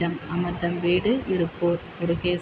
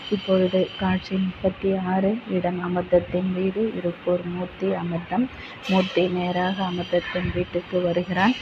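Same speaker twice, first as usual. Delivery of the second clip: ordinary talk, nothing marked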